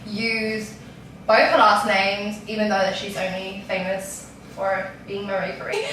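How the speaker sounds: ordinary talk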